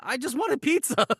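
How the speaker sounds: high, sheepish innocent voice; innocent reply. Retold or imitated. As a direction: play it sweetly innocent. Matt's innocent reply, retold.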